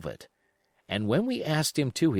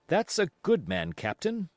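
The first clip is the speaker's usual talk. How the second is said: doctor's approval; 7 semitones higher than usual. Acted. Doctor praises the cook.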